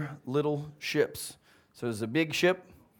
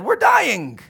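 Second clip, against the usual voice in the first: much higher and louder frightened yell; panicked cry; 13 semitones higher, 11 dB louder than usual. Acted. Disciples yelling at Jesus they're dying, terrified. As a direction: scream it as the boat sinks.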